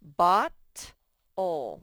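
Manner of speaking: Bot-ol split in two, ending in ol; sounding out words. Shown as a demonstration. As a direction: say Bot-ol in two clear syllables. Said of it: "let's break these up".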